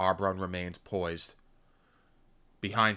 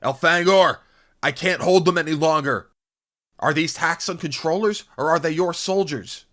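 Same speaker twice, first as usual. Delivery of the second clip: high, loud, strained battle cry; desperate shouting; 9 semitones higher, 9 dB louder than usual. A narrator's reading. Arbron holding back Taxans, shouting to Elfangor urgently. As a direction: shout for help over a mob.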